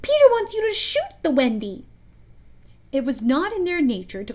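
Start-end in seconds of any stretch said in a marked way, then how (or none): 0.0-1.9 shrill, high fairy voice; Tinker Bell. A narrator's reading. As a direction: ring it out shrilly. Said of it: "the shrill voice of Tinker Bell".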